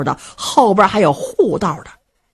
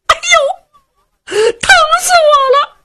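very high mock wail of pain; fake pain cry; 4 dB louder, slower than usual. Luo Cheng shouts from inside to fool his mother, hammed-up pain wail. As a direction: ham up being bitten.

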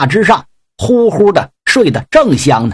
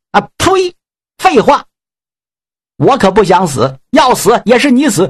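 higher, spitting contempt; scornful god warrior; 5 semitones higher than usual. Acted. Yang Jian scorns the pig demon, higher scornful voice. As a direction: sneer it down at him.

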